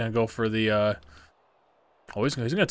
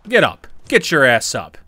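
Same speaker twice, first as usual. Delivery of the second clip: angry shout; 6 dB louder than usual. Real, not acted. Gamer taunting the fallen opponent, shouting. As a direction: bark it down at him.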